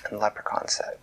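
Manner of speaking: ordinary delivery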